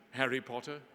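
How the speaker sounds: usual speaking voice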